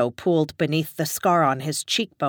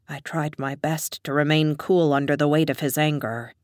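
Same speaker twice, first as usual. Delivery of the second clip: plain reading voice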